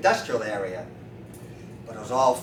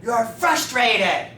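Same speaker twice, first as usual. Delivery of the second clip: loud, high, drawn-out verdict; acted accusation; 7 semitones higher, 5 dB louder, much slower than usual. Street man diagnoses the chiropractor to his face, loud accusation. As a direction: stretch it out and hurl it at him.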